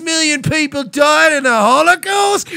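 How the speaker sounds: high, slow, shouted troll voice; shouting bigot. Acted. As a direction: bellow it like a ranting bigot. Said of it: "waiting for somebody to be like".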